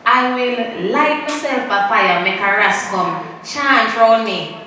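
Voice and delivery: slow, deliberate sermon pace; slow preachy delivery; slowly. Shown as a demonstration. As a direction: spell it out slowly for the congregation. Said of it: "I will say it slowly so everybody can understand".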